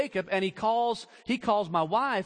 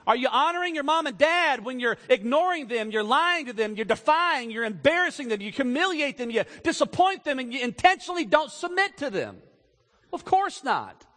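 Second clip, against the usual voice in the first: louder, higher, driving each clause home; preaching at full force; 5 semitones higher, 3 dB louder than usual. Real, not acted. Preacher pressing the congregation with questions, loud and driving. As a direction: hammer the list at the congregation. Preacher building a rhetorical list.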